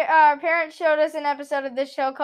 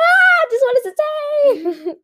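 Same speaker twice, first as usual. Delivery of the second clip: high, slow, exasperated plea; 12 semitones higher, 3 dB louder, slower than usual. Real, not acted. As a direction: beg them to just read it. Exasperated, laughing.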